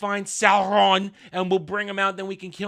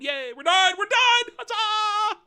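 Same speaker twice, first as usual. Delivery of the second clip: high squealed hammed-up celebration; mock cheering. Reviewer celebrating that the episode is over, mock jubilant squeal. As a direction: cheer in climbing falsetto.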